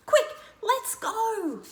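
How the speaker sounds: high, slow-drawn urging; urgent. Acted. Narrator urges friends after the bird, urgent. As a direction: hurry everyone along.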